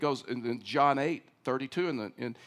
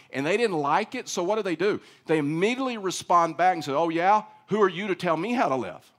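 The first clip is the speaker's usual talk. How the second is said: louder, driving sermon delivery, building to a peak; fervent preaching; 6 semitones higher, 6 dB louder than usual. Acted. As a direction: preach it with rising fire to the congregation. Preaching at full force.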